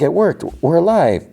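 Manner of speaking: high, thrilled retelling of survival; excited relief. Retold or imitated. Crew member surviving the barrier, excited relief. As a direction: imitate someone giddy to be alive.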